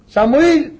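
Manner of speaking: high, drawn-out summons; calling out. Acted. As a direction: call the name into the distance. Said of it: "φωνάζει ο Κύριος".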